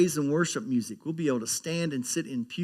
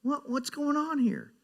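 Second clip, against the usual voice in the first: much higher, baffled mock question; bewildered quote; 12 semitones higher than usual. Pastor acting a confused believer, high and baffled. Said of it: "And then we wonder".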